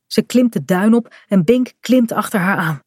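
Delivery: ordinary delivery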